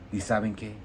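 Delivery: normal talking voice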